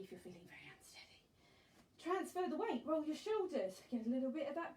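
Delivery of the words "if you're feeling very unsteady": quick soft aside, half whispered. Real, not acted. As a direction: drop to a fast whisper.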